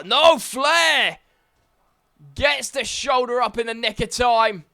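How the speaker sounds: high, loud near-fall yell; excited shout. Real, not acted. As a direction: scream the kick-out in disbelief.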